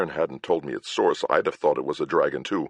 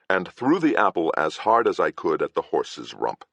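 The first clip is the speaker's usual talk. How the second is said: plain reading voice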